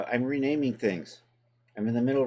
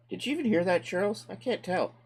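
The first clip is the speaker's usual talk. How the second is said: squeaky voice; 11 semitones higher than usual. Pretend. Squeaky voice to Charles.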